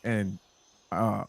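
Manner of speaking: ordinary talk, nothing marked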